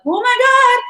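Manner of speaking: high, loud stunned exclamation; mock shock. Coworkers shocked that you quit, high mock gasp of disbelief. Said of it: "And they're like".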